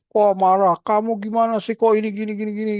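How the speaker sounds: high, sharp mock complaint at the nurse; angry parent. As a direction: play the upset father scolding.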